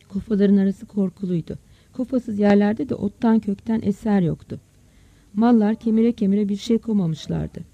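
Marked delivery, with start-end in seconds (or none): none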